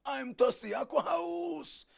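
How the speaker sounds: high, long-stretched call; retold cry. Christ on the cross saying he thirsts, drawn-out cry. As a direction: stretch the last word out high. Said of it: "lalu dia teriak".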